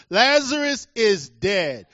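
slow, loud, emphatic statement; speaking plainly. Retold or imitated. Jesus sets his disciples straight on Lazarus, slow and plain. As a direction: spell out "Lazarus is dead" slowly and firmly. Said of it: "Jesus had to speak plainly".

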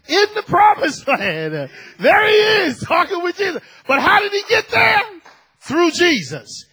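loud, high, stretched preaching cry; fervent preacher lifting the congregation; full-force preaching. Real, not acted. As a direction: build to a shouted proclamation.

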